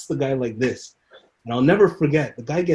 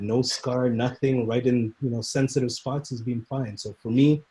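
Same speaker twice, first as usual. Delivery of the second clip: ordinary talk, nothing marked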